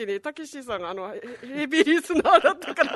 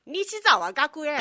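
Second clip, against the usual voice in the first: very slow, drawn-out impression; much slower than usual. Shown as a demonstration. Host asks how it went, slow drawn-out impression. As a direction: draw the name right out as a party-piece impression. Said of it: "どんなんでした？".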